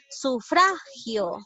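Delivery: Sufragio very slow, with FRA held long; stress on FRA. Shown as a demonstration. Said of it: "Aquí está ubicado la sílaba tónica".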